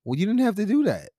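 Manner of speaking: high, loud, amazed outburst; stunned disbelief. Retold or imitated. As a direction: cry out in happy disbelief. Stunned reaction to aunt's kindness.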